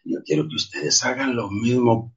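usual speaking voice

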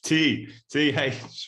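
"T, T H" spelled slowly, letter by letter; spelling it out. Shown as a demonstration. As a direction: spell out "T H" deliberately. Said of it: "threads as in T H".

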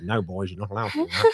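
lightly voiced strict dad; a hint of a stern father. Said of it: "old stern dad".

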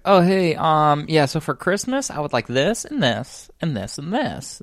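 imitated kid. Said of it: "the kid ... he's like".

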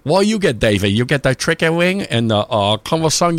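put-on hamming up a Chinese accent while joking about takeout; mock Chinese accent. Teasing his friend Dave about takeout orders in a lightly mock Chinese accent. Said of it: "I used to do my Chinese voice".

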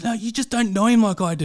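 high-pitched defensive girlfriend impression; pleading girlfriend. Mock girl convincing her friend about her boyfriend, pleading. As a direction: plead his case in a high put-on voice. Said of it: "one of those girls who's".

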